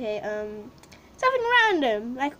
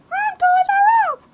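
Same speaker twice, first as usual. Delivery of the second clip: high, louder squeaky character voice; squeaky toy store voice; 6 dB louder than usual. Pretend. Toys R Us answers the kid in a high squeaky voice. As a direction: pipe up like a cartoon shop mascot.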